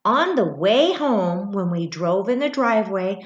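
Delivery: usual speaking voice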